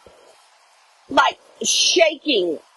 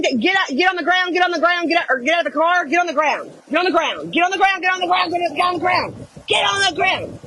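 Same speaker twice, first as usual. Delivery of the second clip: urgent, higher-pitched mock cop commands; imitated police screaming; 4 semitones higher than usual. Cops ordering people down outside the window, retold screaming. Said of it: "screaming at the, at, at somebody".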